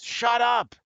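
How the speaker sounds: mock furious husband yelling back; angry caller. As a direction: bark it at someone off the phone. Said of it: "the guy on the phone says".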